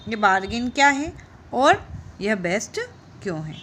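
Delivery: normal talking voice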